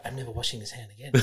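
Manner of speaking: low, soft, quick aside; retold starstruck vow, dropped low and quiet; hushed fan vow. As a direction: murmur it in mock awe.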